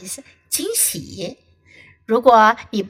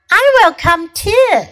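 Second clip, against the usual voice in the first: Bird voice; 9 semitones higher, 5 dB louder than usual. Acted. Bird voiced in read story.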